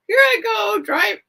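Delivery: excited self-quote. Retold or imitated.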